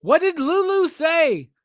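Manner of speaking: insistent repeat. Real, not acted. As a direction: stretch it out and demand an answer.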